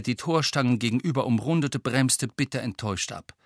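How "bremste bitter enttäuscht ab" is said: ordinary delivery, nothing marked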